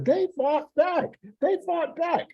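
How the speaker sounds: very high, drawn-out imitation of an excited cousin; imitated cousin's voice. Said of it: "say this in my cousin's voice".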